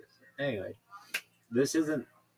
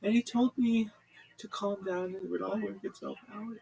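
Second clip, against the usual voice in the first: high, pleading whine of a distressed radio caller; whiny caller; 14 semitones higher, 5 dB louder than usual. Retold or imitated. Distraught caller pleading with the radio host. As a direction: beg the host for help, voice up high.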